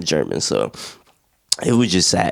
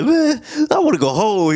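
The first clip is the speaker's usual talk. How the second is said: much higher, whining mock gripe; grumpy soldier moaning that he wants to leave; whiny complaining; 14 semitones higher than usual. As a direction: whine like a fed-up soldier. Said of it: "Schofield was a little mad".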